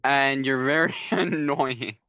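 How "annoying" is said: ordinary talk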